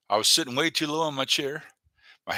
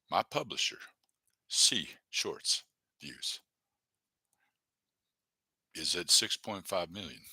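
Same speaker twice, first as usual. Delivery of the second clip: softer, slowing, trailing under his breath; quiet repeat; 8 semitones lower, 7 dB quieter, slower than usual. Real, not acted. Streamer repeating his view count to himself, quiet and trailing. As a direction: mutter it back to yourself.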